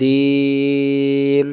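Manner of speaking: দিন with the vowel held very long; three-alif stretch. Shown as a demonstration. Teacher demonstrating the long vowel in দিন. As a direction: draw out দিন over three beats. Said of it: "আর এটা".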